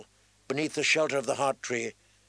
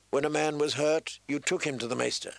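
usual speaking voice

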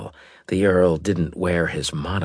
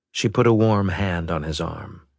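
ordinary delivery

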